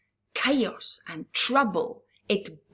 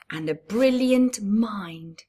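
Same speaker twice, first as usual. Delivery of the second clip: ordinary delivery, nothing marked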